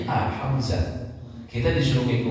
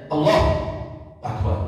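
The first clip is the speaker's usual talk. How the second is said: Allah, a short deliberate break, Akbar; deliberate short cut; 2 semitones higher than usual. Shown as a demonstration. Teacher shows the forbidden deliberate short pause. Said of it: "Tapi kalau dia sengaja".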